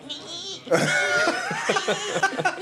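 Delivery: long high-pitched animal shriek; imitated groundhog squeal. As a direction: squeal like a groundhog at his throat. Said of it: "and it's going".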